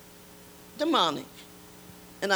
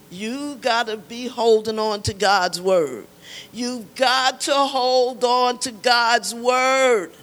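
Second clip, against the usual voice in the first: loud, drawn-out preacher's exhortation; preaching; 3 semitones higher, 7 dB louder, much slower than usual. Real, not acted. Woman testifying urges listeners to hold on, preaching. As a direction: preach it slowly and forcefully, stretching the words.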